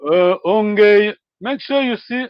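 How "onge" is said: usual speaking voice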